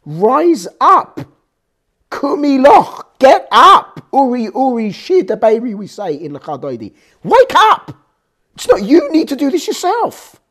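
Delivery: loud divine wake-up call; rousing call. Acted. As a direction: shout to rouse a sleeper. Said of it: "But Hashem is saying".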